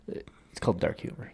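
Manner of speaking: whispered, breathy deadpan; hushed aside. Real, not acted. Whispering to his friend to land the joke. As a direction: drop to a whisper for the punchline. Repeating the punchline, second take hushed.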